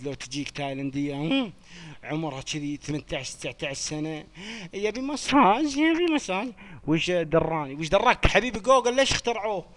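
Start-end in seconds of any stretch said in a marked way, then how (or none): 4.7-6.5 high, slow teasing squeal for يبي مساج; squeaky mock of a cheeky request. Mocking a viewer's request. (صوت ساخر رفيع وبطيء)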